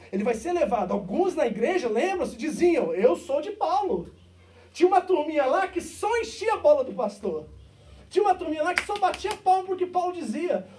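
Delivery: loud, high, building sermon climax; fiery preaching. Acted. Preacher stirring up the congregation, loud and fervent. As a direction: preach it out hard to the church.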